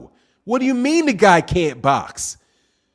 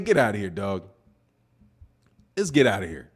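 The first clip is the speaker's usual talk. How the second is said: ordinary delivery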